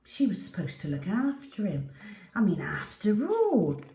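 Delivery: ordinary delivery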